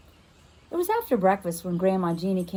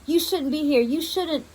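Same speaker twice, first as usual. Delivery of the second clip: put-on higher, louder old woman's warning; frightened grandma; 7 semitones higher, 4 dB louder than usual. Grandma warns the strange girl away, shaken and high. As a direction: warn her off, shaken.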